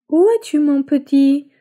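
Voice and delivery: high, loud call across the woods. Acted. Mother searching the forest for her son, calling loudly. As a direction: call for a lost child from afar. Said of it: "crie sa maman depuis la forêt".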